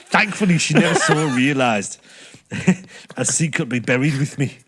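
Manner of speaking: put-on northern English accent, part Geordie; Yorkshire accent drifting Geordie. Shown as a demonstration. Finishing the confession on request, wobbly Yorkshire-Geordie accent. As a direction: aim for Yorkshire, slip towards Geordie. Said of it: "somehow Geordie as well".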